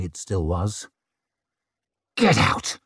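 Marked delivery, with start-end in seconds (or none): 2.1-2.8 high, tight furious snarl; snarled through gritted teeth. Acted. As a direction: spit it through clenched teeth. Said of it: "he snarled through gritted teeth".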